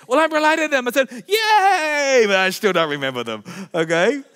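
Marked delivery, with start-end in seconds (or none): 1.3-2.2 high, loud yay; hammed-up hooray; mock cheer. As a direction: fake delight.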